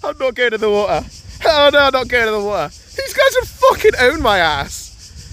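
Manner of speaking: high loud no way; loud refusal. Real, not acted. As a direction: refuse loudly. Refusing to jump in.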